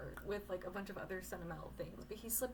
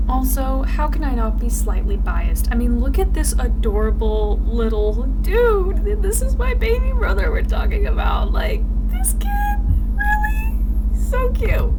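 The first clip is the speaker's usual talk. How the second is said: very loud, high squealing over an adorable photo; real delight: shriek and coo at the cute little dude; gushing squeal; 5 semitones higher, 24 dB louder than usual. Real, not acted. Gushing over cute baby brother.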